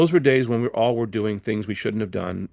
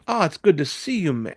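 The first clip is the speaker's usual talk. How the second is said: friendly greeting; 6 semitones higher than usual. Retold or imitated. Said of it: "he was really nice to me".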